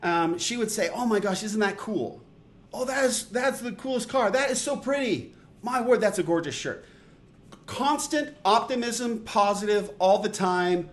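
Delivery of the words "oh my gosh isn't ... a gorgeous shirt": high, bubbly gushing praise; lightly mimicking a cheerful girl's gushing. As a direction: gush like an eager optimist. Imitating her gushing compliments.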